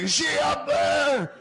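put-on high, slow drunken shout; slurred drunk bellow from the yard; drunk yelling. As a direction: holler it up at the balcony.

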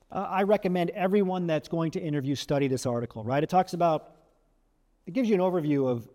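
ordinary talk, nothing marked

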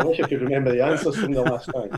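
laughing. Real, not acted. Repeats the joke while laughing.

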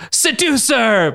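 high, drawn-out mock scream; hammed-up dramatic cry; screaming accusation. A narrator's reading. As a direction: stretch the accusation out at high pitch. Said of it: "Belladonna screamed".

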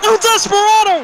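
excited shout. Real, not acted. Wrestler appears, screaming name.

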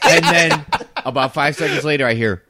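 ordinary talk, nothing marked

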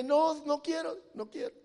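lightly acted defiance, fading at the end; rebellious refusal. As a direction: imitate a balky prophet. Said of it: "Jonás el rebelde".

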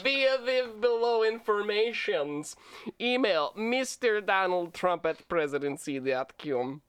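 hammed-up Russian video game villain; cheesy Russian bad-guy accent. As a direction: read it like an eighties-movie Russian bad guy. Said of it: "Russian video game bad guy".

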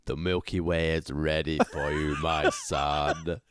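lightly voiced villain, low and hushed; mock mystic villain. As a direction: croon it like a cult leader. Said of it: "repeating the villain promo line".

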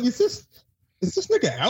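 high, laughing disbelief; half-laughing mock suspicion; mock worried friend. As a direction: squeak it out like you can't believe it. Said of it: "I was like".

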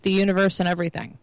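usual speaking voice

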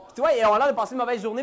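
ordinary talk

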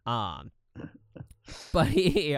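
usual speaking voice

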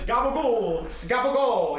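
"gabagool" high and loud with a hard G; "gabagool" called out, ending in a long ool; movie-line pronunciation. Retold or imitated. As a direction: stretch "gabagool", starting on a hard G. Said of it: "He says it with a G first".

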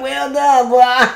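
loud, high mocking imitation; imitated old-school cat. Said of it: "It's like".

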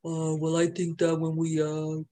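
low, deep imitation of a coworker. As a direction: drop right down into a deep mock voice. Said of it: "talk about a fucking deep voice".

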